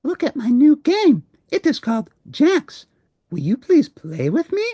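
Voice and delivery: very high, eager little voice; a small monster begging to play; Elmo voice. Acted. Elmo shows Grover his game.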